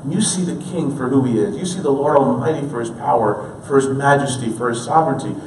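ordinary delivery

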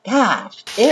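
usual speaking voice

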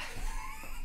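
a high, strangled laugh-cry; incredulous laugh-shriek. Real, not acted. Penalty given against him.

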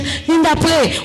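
Finda, pray said high and pleading; high urging. Retold or imitated. The friend urging Finda to pray, high pleading voice. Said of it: "He said".

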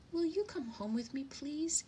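high, pleading little bird voice; chirpy, polite request; eager sparrow. A narrator's reading. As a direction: invite hopefully, pitched high. Happier sparrow invites the dove.

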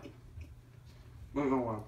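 slow, mumbled through dry crackers; mouth full. Real, not acted. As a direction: talk round a mouthful of crackers.

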